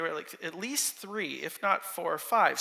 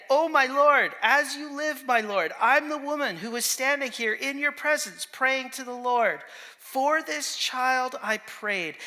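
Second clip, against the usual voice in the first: raised, declaimed plea; impassioned scripture quote; 5 semitones higher, 4 dB louder than usual. Acted. Hannah addresses the priest at Shiloh, raised and declamatory. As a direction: declaim it higher, with sustained emphasis. Reads Hannah's speech to Eli aloud.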